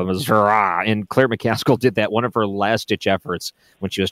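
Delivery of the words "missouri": Missouri ending in a drawled uh, Missour-uh; southern drawl Missouri. Retold or imitated. Host mimicking the rural pronunciation, Missouri drawled as Missour-uh. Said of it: "They get a southern draw".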